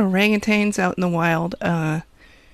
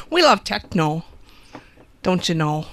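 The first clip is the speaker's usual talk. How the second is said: exaggerated Wisconsin accent; hammed-up Upper Midwest twang. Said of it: "Wisconsin accent there".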